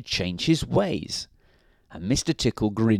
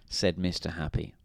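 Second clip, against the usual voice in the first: usual speaking voice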